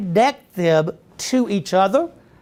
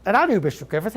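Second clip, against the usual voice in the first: ordinary delivery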